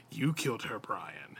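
unvoiced, soft creature whisper. A narrator's reading. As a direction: hiss the accusation softly like a monster.

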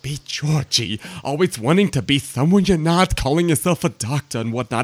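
high mocking mother scolding her grown son.

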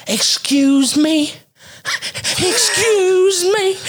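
high, drawn-out mock yell; imitated yelling. Neighbor lady calling into the yard, mock yelling. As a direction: mimic a woman hollering for attention, stretching each word. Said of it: "I hear somebody yelling".

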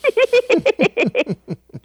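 high cackling witch laugh; evil witch cackle. Real, not acted. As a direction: let a wicked witch cackle slip out. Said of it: "that was my evil witch cackle".

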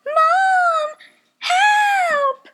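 high, loud cries calling for mom; panicked yell for help. Retold or imitated. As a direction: scream for help as if trapped in the dream. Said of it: "I was not screaming".

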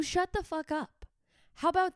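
sharp high punchline. Real, not acted. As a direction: snap it out high and pointed. Rant punchline.

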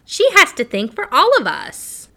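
high, loud, squeaky little doll; squeaky penny doll. A narrator's reading. Penny doll crying out about the others, squeaky voice. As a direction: squeak it out like a tiny toy. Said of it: "cried the little penny doll in a squeaky voice".

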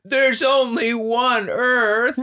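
put-on high, slow wailing child; hammed-up drawn-out wail; mock bawling. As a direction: imitate a little kid sobbing out words. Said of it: "and he's bawling".

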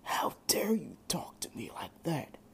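soft, breathless whispering demon; demon king whisper. A narrator's reading. Demon king whispering inside Drake's head, menacing whisper. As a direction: hiss it like a voice inside his head.

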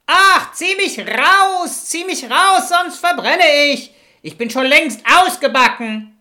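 very high, loud, urgent cry for help; screaming bread. Acted. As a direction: scream to be pulled out of the oven. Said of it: "schrie das Brot wieder". (schrill schreiendes Brot, laut flehend)

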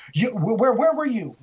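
high, agitated retold demand; retold snapping. Shaken ranger confronts the photographer after the scare, retold snapping. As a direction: act out him snapping, pitch jumping up. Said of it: "and he just snapped".

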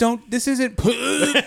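high, loud, drawn-out mock retching speech; gagging. Tricked kid protesting to mom, imitated gagging. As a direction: squeeze the words out through a fake gag. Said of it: "she keeps, like, gagging".

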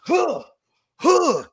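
boxer's punch grunts. Retold or imitated. Acting out the rooftop boxer fighting Jason, punching grunts. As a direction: imitate effort grunts with each jab.

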